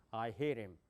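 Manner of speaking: I hit him low and linked, "ai herim"; English way. Shown as a demonstration. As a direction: run I hit him together deep in the throat. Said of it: "제가 영어로 해볼게요". (영어식으로 낮게 굴리듯 이은 I hit him)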